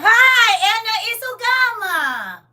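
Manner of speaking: very high, loud, slow sing-song greeting; squeaky, stretched grandma hello; baby-greeting voice. Acted.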